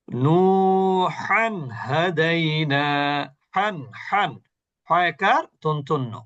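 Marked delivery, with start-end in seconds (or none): 0.1-4.3 নূহান হাদাইনা slowly chanted with long vowels; izhar recitation. Shown as a demonstration. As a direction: draw out নূহান, clean n in হান without a hum. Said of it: "ইজহার, এখানে গুণনা হলো না". (নূহান হাদাইনা টেনে, হান-এর নুন স্পষ্ট)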